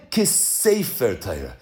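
slow, low and weighty on "sefer"; deliberate reading that leans on the word "sefer"; stress on sefer. Shown as a demonstration. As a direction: draw out "sefer" to make the point. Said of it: "Emphasis on sefer".

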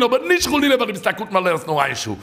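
high-pitched urgent sermon delivery; impassioned preaching. Real, not acted. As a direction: preach it high, driving the point home. (פייערדיגע דרשה, הויך און דרינגענד)

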